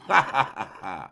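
short falling bitter laugh; a brief scornful laugh. A narrator's reading. Pierre ends his jibe with a short bitter laugh.

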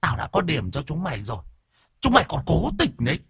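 very high, strained ghost voice hurling curses; cursing ghost. A narrator's reading. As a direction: spit the curses out as the angry spirit. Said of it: "Bấy giờ Vong mới nói".

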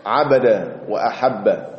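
normal talking voice

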